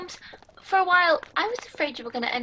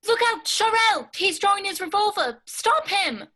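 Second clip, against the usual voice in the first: much higher, loud urgent yell; shouted warning; 7 semitones higher, 3 dB louder than usual. Acted. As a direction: shout the alarm across the room.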